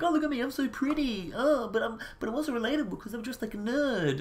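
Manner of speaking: high, exaggerated girly mockery; mocking pretty girl. Commentator parodying a comedian showing off, mocking high voice. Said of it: "you're just mocking people".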